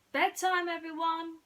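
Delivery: high, loud call to everyone. Acted. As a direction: call the mice to bed across the attic. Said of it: "she called".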